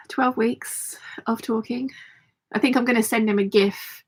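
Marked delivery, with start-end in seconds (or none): 0.1-1.9 slow weary whisper. Real, not acted. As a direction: whisper it slowly, exasperated. Weary aside after sigh.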